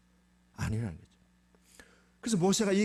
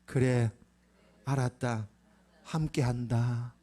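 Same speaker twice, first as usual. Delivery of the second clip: God's solemn answer; 4 semitones lower, slower than usual. Acted. God's answer, led for repeating.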